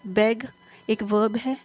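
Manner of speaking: normal talking voice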